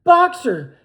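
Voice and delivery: high, loud anguished cry; terrible cry. A narrator's reading. Clover crying to Boxer at the van window, terrible voice. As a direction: scream his name in horror. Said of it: "cried Clover in a terrible voice".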